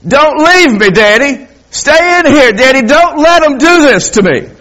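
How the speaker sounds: put-on high, loud, desperate child's cry for daddy; a terrified boy begging his father not to leave; pleading child. Frightened son begging his daddy not to leave him.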